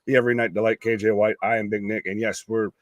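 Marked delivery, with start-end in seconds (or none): 0.0-1.3 loud ring announcer introduction; ringmaster. Acted. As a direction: announce the champion to the arena.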